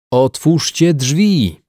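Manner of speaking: slow, high, loud cry for help; shouted call. A narrator's reading. Trapped man calls to those outside the door, shouting. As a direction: shout each word so it carries through the door. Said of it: "zawołał".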